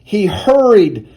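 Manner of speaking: ordinary delivery, nothing marked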